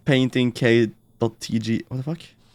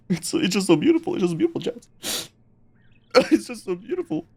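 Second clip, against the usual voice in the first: high, soft, choked-up gushing; tearful; 8 semitones higher, 7 dB quieter, faster than usual. Pretend. Streamer tells chat how beautiful it is, playfully tearful. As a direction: squeak it out softly as if overcome. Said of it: "I'm crying".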